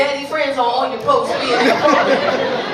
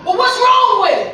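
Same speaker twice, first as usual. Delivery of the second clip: loud, high incredulous shout; can't believe it, yell; laughing yell; 7 semitones higher, 8 dB louder than usual. Retold or imitated. Heated quarrel over dinosaur picture.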